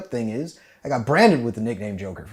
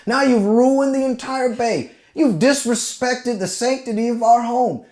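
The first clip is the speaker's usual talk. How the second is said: much higher, hammed-up scolding; mock outraged sergeant; 11 semitones higher, 4 dB louder than usual. Sergeant scolding Jackson for wrecking the bay, mock outrage. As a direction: scold with fake solemnity. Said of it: "And he's like".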